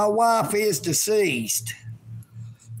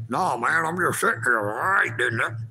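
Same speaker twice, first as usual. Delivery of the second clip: lower, mumbled voice for the drone; 7 semitones lower, faster than usual. Acted. Vlogger answers for the spy drone, low and lazy. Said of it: "You got anything to say?".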